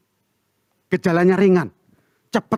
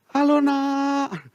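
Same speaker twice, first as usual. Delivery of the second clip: slow, higher, tearful greeting; tearful encouragement; 10 semitones higher, much slower than usual. Retold or imitated. Father calling to his children from outside, tearful and slow. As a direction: hold back tears while cheering them on. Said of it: "Sambil air mata mengalir".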